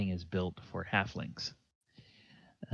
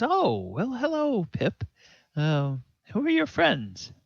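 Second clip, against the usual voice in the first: higher, polite and a bit puzzled; a friendly but wary mother; halfling farm woman; 12 semitones higher, 3 dB louder than usual. Acted. Farm woman greeting Pip and the strangers, higher and polite. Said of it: "she says".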